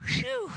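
high, airy sigh of relief; exaggerated whew of relief; relieved whew. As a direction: let out a high mock phew. Questioners relieved at John's answer.

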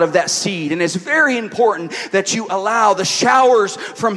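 emphatic, projected sermon delivery; preaching. Real, not acted. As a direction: preach it to the room, driving each point home. Preaches at full force.

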